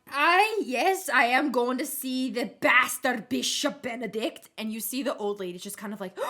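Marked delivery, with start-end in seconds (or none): 0.1-4.5 put-on defiant drunk Grena. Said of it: "She's, she's like".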